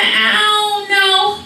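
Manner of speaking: put-on high, drawn-out kid whine; mock childish helplessness; child's whiny answer. Child answers dad again.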